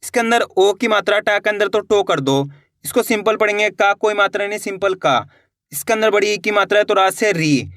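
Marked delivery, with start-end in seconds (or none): none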